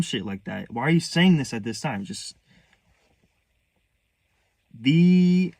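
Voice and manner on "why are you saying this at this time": raised, louder accusing question; heated, exasperated imitation; retold scolding. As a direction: mimic an angry relative's rebuke. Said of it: "They started screaming at me".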